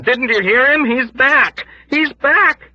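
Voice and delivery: high, loud panicked shout; frantic shouting. Acted. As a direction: yell it in disbelief. Frantic ward patient shouting.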